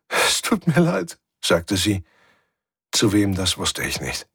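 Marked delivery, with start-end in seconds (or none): 0.1-1.2 high, slow, crying voice; tearful apology. Acted. Svensson weeps as she apologises, tearful. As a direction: apologise through tears. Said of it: "Sie begann wieder zu weinen".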